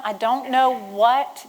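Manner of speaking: ordinary talk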